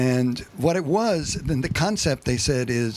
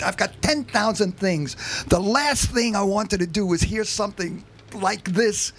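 higher, eager pleading rock star; 6 semitones higher than usual. Retold or imitated. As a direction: beg for the track, all excited. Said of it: "This is [name]".

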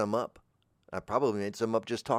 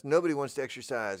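ordinary delivery

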